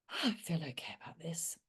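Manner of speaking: soft whispered reassurance; quiet whispered self-comfort. Shown as a demonstration. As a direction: breathe it out gently to yourself. Demo calm inner thought.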